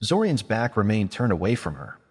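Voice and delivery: ordinary delivery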